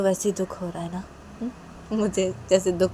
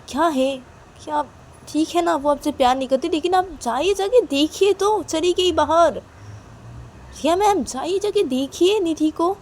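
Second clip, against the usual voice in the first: higher, louder insistent urging; urgent pushing; 9 semitones higher, 5 dB louder than usual. Acted. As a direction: push her out the door, voice up high. Reshma urges Riya to go.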